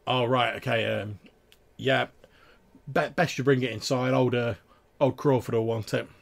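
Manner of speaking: a lightly voiced guard, easygoing and friendly; loud, chummy guard greeting a regular; gate guard. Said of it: "the guard's like".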